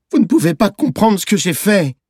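put-on very high, desperate child's cry; hiccuping protest. A narrator's reading. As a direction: insist through the upset. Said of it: "il a octé". (cri d'enfant désespéré, très aigu)

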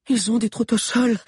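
high, breathless warning; panting. A narrator's reading. Lunella warning the others as she runs, panting. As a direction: gasp it out while running. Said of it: "haletait Lunella".

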